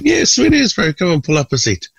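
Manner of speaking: Tim's easy reply. Retold or imitated. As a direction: welcome the fan casually. Tim quoted answering the fan.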